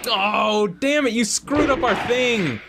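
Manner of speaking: high-pitched exasperated cry; exasperated complaint. Real, not acted. Blaming co-player for screwing up our thing, exasperated. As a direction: protest loudly, voice way up. Complains the joke was spoiled.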